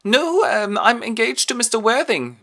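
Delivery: put-on high, loud girlish objection; hammed-up high young lady; mock girlish protest. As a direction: whine it like a defiant daughter. Gwendolen defies her mother.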